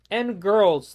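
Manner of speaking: ordinary delivery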